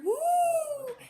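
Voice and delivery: a very high hooting woo; owl holler. Acted. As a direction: hoot it high like a startled owl. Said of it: "who hollered".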